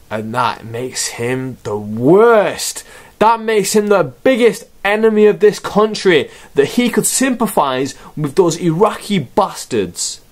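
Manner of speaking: hammed-up patriotic indignation, building; mock outrage. Mock propaganda outrage at wrestler.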